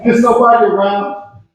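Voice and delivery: ordinary talk, nothing marked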